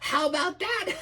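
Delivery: high, gleeful laughing line; high voice breaking into laughter. Acted. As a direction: crow it with delight. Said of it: "he laughed".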